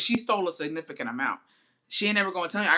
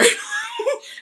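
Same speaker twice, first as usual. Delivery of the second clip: loud high cackle; sudden loud laugh; burst of laughter; 6 dB louder than usual. Real, not acted. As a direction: break into a big laugh. Laughing at own story.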